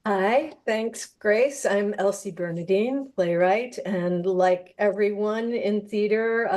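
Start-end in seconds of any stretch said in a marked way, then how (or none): none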